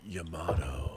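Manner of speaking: Yamato whispered, soft and breathy; sexy whisper. Shown as a demonstration. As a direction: breathe Yamato like a seductive secret.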